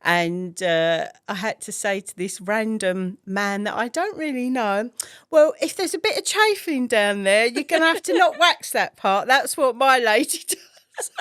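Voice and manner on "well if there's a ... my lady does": laughing. Retold or imitated. Retold embarrassing request, laughing.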